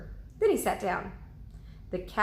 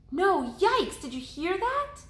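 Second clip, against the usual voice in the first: high, loud frightened alert; startled by a sound; alarmed; 12 semitones higher, 3 dB louder than usual. Acted. Annie hears a growl.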